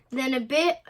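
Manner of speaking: plain reading voice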